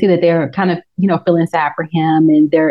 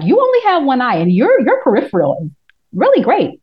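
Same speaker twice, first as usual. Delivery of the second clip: high impressed quip; 6 semitones higher than usual. Retold or imitated. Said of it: "I'm like".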